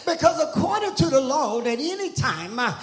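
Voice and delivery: driving sermon cadence, climbing into high, strained cries; fiery church preaching, building in pitch; impassioned preaching. Real, not acted. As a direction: preach with mounting fervour, dropping for asides. Preaches at full force.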